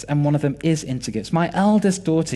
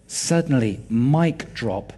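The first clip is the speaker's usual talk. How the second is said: ordinary talk, nothing marked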